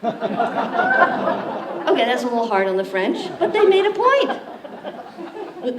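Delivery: laughing through the words; chuckling at own joke; amused laugh after the punchline. Real, not acted.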